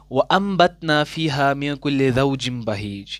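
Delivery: slow, drawn-out Quranic recitation. Shown as a demonstration. Teacher recites verse for students, slow tajweed recitation. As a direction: recite the verse slowly with nasal ghunna. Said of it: "यहाँ पर आप ध्यान से देखिएगा".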